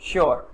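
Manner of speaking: श्योर repeated with sh; correct sh sound. Shown as a demonstration. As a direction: say श्योर starting on sh, not s. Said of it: "श्योर बोलेंगे". (श्योर की शुरुआत 'श' से करें)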